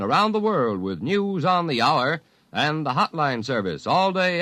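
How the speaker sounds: ordinary talk, nothing marked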